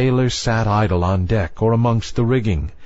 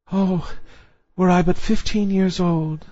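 put-on higher, wistful young girl's voice; young mermaid voice; 9 semitones higher than usual. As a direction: sigh it like a longing young girl. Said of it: "said she".

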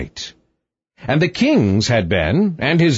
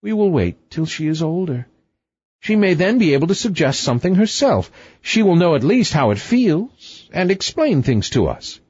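higher-pitched, consoling queen; comforting queen; 7 semitones higher than usual. A narrator's reading. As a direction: reassure the king in a high voice. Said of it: "The queen tried to comfort him".

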